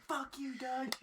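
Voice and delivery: high-pitched yell held down in volume; squeaky taunt out of a car window; mock yell. Friends heckling Doug from the car window, high mock yell. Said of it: "rolled down our windows and yelled".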